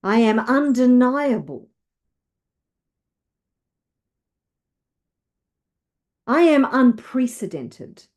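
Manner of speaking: emphatic, declarative affirmations; rousing self-belief, leading others to repeat; with conviction. Shown as a demonstration. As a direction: declare each one like you mean it. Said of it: "Say it with conviction".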